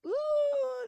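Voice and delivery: high drawn-out spooky "ooh"; mock-eerie high wail. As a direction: play up a ghostly ooh. Warning of something scary coming.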